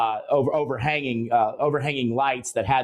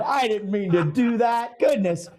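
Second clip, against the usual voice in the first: mock outrage; 8 semitones higher than usual. Host caught holding a prank note, mock exclaiming.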